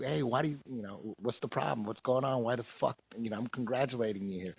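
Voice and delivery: softly asking what's wrong; quiet bewildered retort; hushed confused protest. Retold or imitated. He replies, confused.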